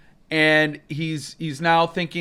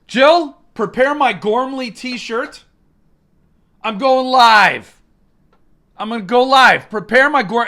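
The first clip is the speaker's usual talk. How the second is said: mock grand command; 6 semitones higher, 6 dB louder than usual. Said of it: "I said, I said".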